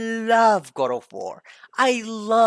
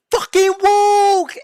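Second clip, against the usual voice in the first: drawn-out, much higher, much louder yelled "Fucking woke!"; mock outraged gamer; 10 semitones higher, 9 dB louder, slower than usual. Mocking angry commenters, a slow high yell. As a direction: ham up an angry online complainer's slow yell. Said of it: "they were like".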